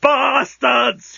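high screamed curse; old genius screaming at the sheets. Acted. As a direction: scream it out, finally cracking. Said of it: "he screamed".

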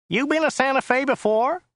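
high, nasal driver voice; Joe's nasal whine. A narrator's reading. Driver making small talk with his passenger, high and nasal. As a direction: whine it high through the nose. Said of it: "nasaled Joe".

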